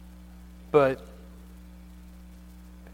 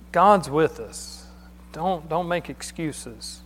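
slow emphatic preaching; slower than usual. Real, not acted. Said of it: "preacher stressing the point".

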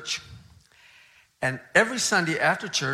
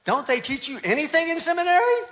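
indignant complaint; 6 semitones higher, 4 dB louder than usual. Retold or imitated. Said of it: "He was furious".

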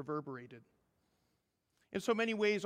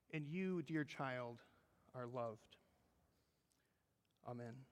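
tender blessing; 4 semitones lower, 8 dB quieter than usual. Real, not acted. Closing blessing to the congregation.

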